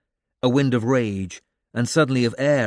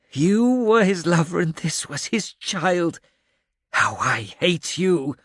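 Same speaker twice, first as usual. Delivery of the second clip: hateful tirade; 7 semitones higher, 3 dB louder than usual. A narrator's reading. The old man rages at the ghost of the woman he hates, loud and bitter. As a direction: spit out seventeen years of hatred.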